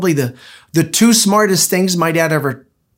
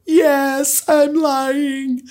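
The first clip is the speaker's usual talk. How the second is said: put-on much higher, innocent little girl admitting it; 12 semitones higher, much slower than usual. Imitating daughter Lauren, high child voice owning up to the lie. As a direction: pitch way up like a guileless small child.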